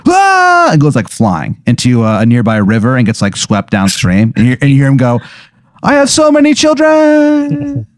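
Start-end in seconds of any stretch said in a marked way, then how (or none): 0.1-0.7 very high held cry; imitated scream of a man struck; mock scream. Burglar blasted by lightning, mock high scream. 5.8-7.9 high, drawn-out mock wail; distant wailing cry. Burglar swept down the river, high mock wail.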